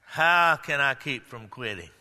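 low, soft, weary mock whine; imagined doubter complaining to the preacher, low and weary; discouraged complainer. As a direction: sigh it like someone ready to give up. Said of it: "somebody might say".

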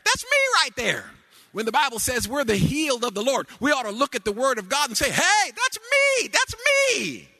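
high, loud whooping sermon cadence; preaching. Acted. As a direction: build the congregation up with each line. Preaching at full force with organ.

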